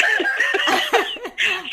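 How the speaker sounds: high bursting laugh; real sheepish laughter. Real, not acted. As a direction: laugh it off, embarrassed. Laughing apology.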